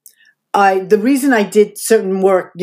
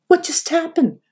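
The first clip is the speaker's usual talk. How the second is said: high, hushed what just happened; a touch of mock disbelief; stunned disbelief; 9 semitones higher than usual. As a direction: ask it high and bewildered. Retold shock after she left.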